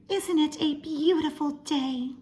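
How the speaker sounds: Mrs Watson voice. Acted. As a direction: lift up into a cheerful Mrs Watson. Mrs Watson voiced in read-aloud.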